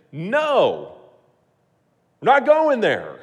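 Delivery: loud, high, firm no; firm refusal. Pretend. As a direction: shut it down hard.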